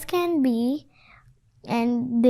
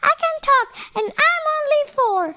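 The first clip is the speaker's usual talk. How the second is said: very high squeaky toy voice; high-pitched little puppet voice; squeaky teddy; 3 dB louder, much faster than usual. A narrator's reading. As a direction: squeak it as a tiny talking teddy. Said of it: "held up his teddy and squeaked".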